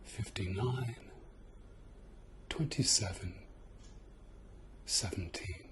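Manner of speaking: whispered numbers in the ear. Real, not acted. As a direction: breathe the numbers softly, close in.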